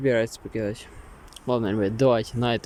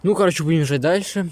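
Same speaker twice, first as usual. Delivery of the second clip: wind on mic; 6 semitones higher, 6 dB louder than usual. Real, not acted. Strong gusts while filming outside.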